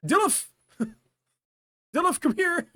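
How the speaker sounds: high, playful calling voice; high-pitched summons. Acted. Barista beckoning the Dilf over, high calling. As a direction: call him over in a high voice.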